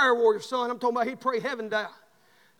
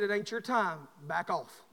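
softer, lower voice of God within; quiet inner voice; 6 semitones lower, 8 dB quieter, slower than usual. Retold or imitated. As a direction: hush it down, a calm inner warning. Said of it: "God spoke in my voice".

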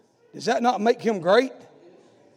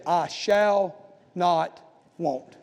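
very slow, quiet, drawn out; slow preaching; 6 semitones lower, 4 dB quieter, much slower than usual. Acted. Preacher quoting David, slow quiet drawl. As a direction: stretch every word of I shall not want.

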